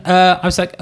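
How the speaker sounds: ordinary talk, nothing marked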